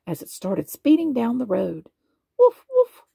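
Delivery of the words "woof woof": high, loud playful woof; puppy barking. A narrator's reading. Puppy barks from the fire engine, high and loud. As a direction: yap it out like an excited puppy. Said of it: "Lucky barked".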